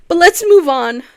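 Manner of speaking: sudden cheerful burst; bright loud reset. Real, not acted. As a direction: shake it off loudly. Snapping out of sadness.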